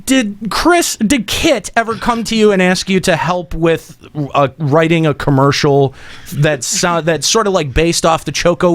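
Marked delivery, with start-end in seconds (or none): none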